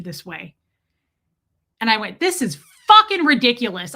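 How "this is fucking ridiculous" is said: loud retold angry complaint; public outburst. Customer at the door before leaving, loud angry outburst. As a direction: blow up, punching the swear word. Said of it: "I just did that out loud".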